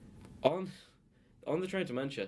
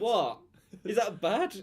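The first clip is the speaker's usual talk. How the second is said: defensive surprise; 10 semitones higher, 7 dB louder, much slower than usual. Real, not acted. Caught out by God remark.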